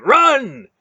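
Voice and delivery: very high, loud yell; frantic shout. Acted. Relg yelling at everyone to flee, loud. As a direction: scream the warning.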